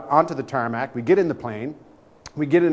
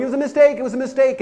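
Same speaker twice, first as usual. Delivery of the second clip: high, frantic insisting; panicked pleading; 11 semitones higher, 3 dB louder, faster than usual. Retold or imitated. Naked guest pleading with the angry man, high panicked voice. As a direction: beg through the door that it was an accident. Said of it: "And I'm going".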